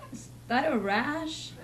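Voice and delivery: normal talking voice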